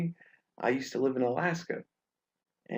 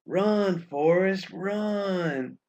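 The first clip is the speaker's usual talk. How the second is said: movie quote shout; 6 semitones higher, 5 dB louder, much slower than usual. Retold or imitated. Quoting the movie line.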